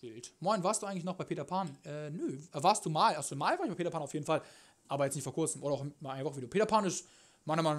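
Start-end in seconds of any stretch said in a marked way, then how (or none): none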